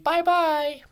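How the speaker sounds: high, loud sing-song bye-bye; the farewell bye-bye, set against the word "by". Shown as a demonstration. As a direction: chirp it like waving goodbye. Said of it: "but no, it's different than that".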